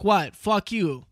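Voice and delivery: imitating a comic's loud dismissive retort; mock defiant shout. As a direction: bark it back at the crowd, not caring. Retold comic snapping at crowd.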